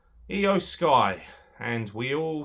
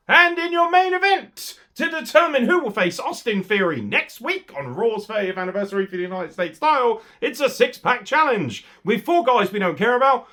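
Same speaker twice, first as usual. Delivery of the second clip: ring announcer; 9 semitones higher, 6 dB louder than usual. Pretend. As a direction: hype the main event like an arena announcer. Announces main event like ring announcer.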